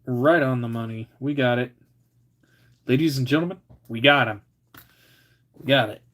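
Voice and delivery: loud, proud announcer-style cheer; triumphant. Real, not acted. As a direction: announce the win to a crowd.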